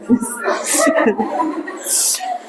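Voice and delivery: soft, breathy laugh. Real, not acted. Teacher amused while showing the class notes, soft laughing. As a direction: laugh quietly without words.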